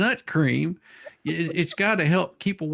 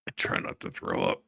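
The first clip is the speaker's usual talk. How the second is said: whispered aside; 8 dB quieter, faster than usual. Real, not acted. Muttered aside.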